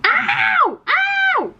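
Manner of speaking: high, loud yelps; real pained yelp. Real, not acted. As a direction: shriek in real pain. Pet bites or scratches her.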